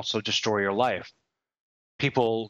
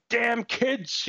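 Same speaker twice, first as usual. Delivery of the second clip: a lightly voiced old grouch, higher and slow; grumpy old man; 11 semitones higher than usual. Catching himself sounding old, a hint of a grumpy old man. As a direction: grumble it like a crotchety old man. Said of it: "I caught myself saying".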